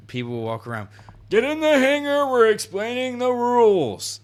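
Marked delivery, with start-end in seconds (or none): 1.3-4.2 much higher, louder nagging mock voice; mock bossy player. Roleplay lobby leader ordering everyone around, mock bossy yell.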